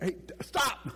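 sudden high alarmed stop call; urgent warning. Retold or imitated. Friend warning someone walking blindly toward a ravine, alarmed. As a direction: catch someone before they step off the edge.

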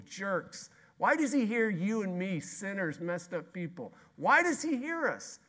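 high, loud, ringing sermon; fiery preacher building to a peak; full-force preaching. Acted. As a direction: preach it out with mounting wonder.

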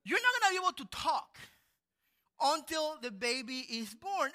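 fast, high, loud warning, slowing to the end; stern angel sentence. Retold or imitated. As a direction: pronounce the punishment. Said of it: "he said".

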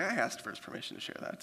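half-voiced aside, dropped way down; whispered aside. Real, not acted. Pastor whispering an aside to the congregation. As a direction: slip it in under your breath.

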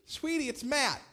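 put-on talking to a toddler; sweet talk to a toddler. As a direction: coax a toddler on the phone. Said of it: "So I said, I said".

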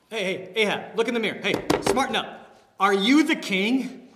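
paraphrasing a queen berating the king; scolding Jezebel. Acted. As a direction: snap at her husband to smarten up. Voicing Jezebel scolding Ahab.

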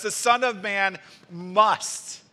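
slow verse, landing hard on "must"; emphatic MUST. Shown as a demonstration. Preacher showing how emphatic Jesus was, slow stress on "must". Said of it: "It's emphatic".

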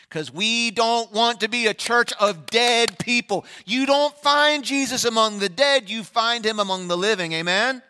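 loud, high preaching cry; booming pulpit declaration; full-force preaching. Acted. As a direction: proclaim it to the whole church.

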